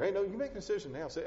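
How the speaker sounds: ordinary talk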